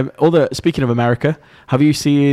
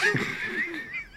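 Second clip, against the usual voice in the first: high squealing laugh; 13 dB quieter than usual. Real, not acted.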